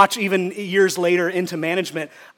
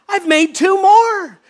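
much higher and louder, slow and proud; pumped up; 11 semitones higher, 6 dB louder, slower than usual. Acted. Second servant reporting his profit, thrilled. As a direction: savour every word with glee. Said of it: "They're, they're pumped up".

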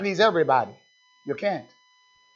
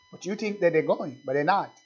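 ordinary talk, nothing marked

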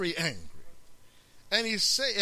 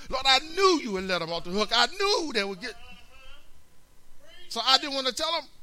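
Jonah's grudge; 8 semitones higher, 5 dB louder, faster than usual. Acted. Preacher voicing Jonah's complaint.

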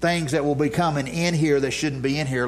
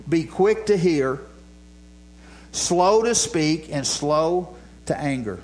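slow, deliberate reading; 2 semitones higher, slower than usual. Shown as a demonstration. Reads the verse slowly.

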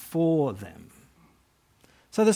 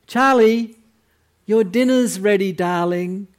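sweet, high sing-song call; calling kids to dinner; 5 semitones higher, 6 dB louder than usual. Retold or imitated. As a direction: call the child lovingly. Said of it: "So you call your children for dinner".

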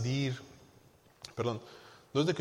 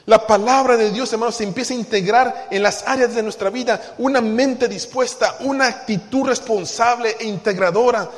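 loud, raised-pitch sermon delivery; forceful preaching; 5 semitones higher, 9 dB louder than usual. Real, not acted. Preacher telling brothers about an integrating attitude, forceful preaching. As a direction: drive it home to the congregation at full voice.

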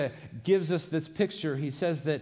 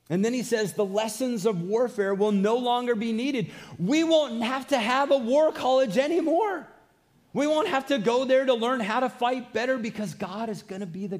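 louder, higher preacher's fervor; fervent preaching; 7 semitones higher, 4 dB louder than usual. Acted. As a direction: preach it out with conviction. Preacher rises to full force.

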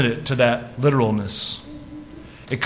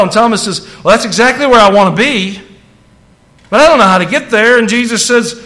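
raised, loud preacher's voice building; fervent preaching; 7 semitones higher, 7 dB louder than usual. Acted. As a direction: preach it out to the congregation. Retells at preaching pitch.

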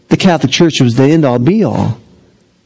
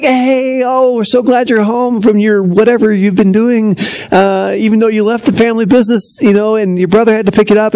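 lightly voiced mom, higher; guilt-tripping family; 7 semitones higher than usual. Family greeting, guilt trip.

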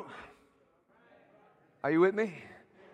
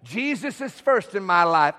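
firm spoken declaration for all to repeat; said out loud; 5 semitones lower than usual. Shown as a demonstration. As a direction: declare it aloud to the congregation. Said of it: "Say it out loud".

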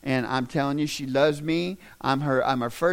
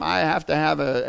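ordinary delivery

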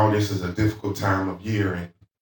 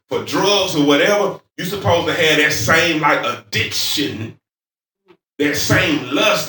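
raised, fervent pulpit delivery; preaching; 6 semitones higher, 4 dB louder than usual. Real, not acted. Preacher urging the congregation to crave God, fervent preaching. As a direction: drive the key words home, drawing them out.

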